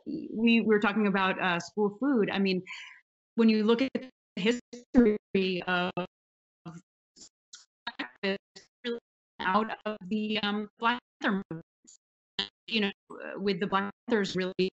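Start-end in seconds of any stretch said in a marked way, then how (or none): 3.3-14.7 choppy call audio cutting out; bad connection, words dropping out; breaking up. Real, not acted. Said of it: "we're cracking up a little bit here".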